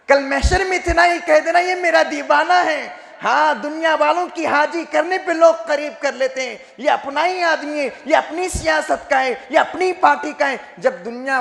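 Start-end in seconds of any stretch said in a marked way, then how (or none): 0.8-3.1 couplet recited with rising fervour; preacher reciting devotional verse; poetic recitation. Real, not acted. 3.2-10.6 high, raised preacher's voice; fervent sermon delivery; impassioned preaching. Real, not acted.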